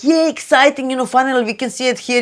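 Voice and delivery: high, fast, delighted gush; excited cheer. Retold or imitated. Believers seeing it happen, cheering high and fast. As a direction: gush like a longtime believer finally proven right. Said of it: "it's gonna be like".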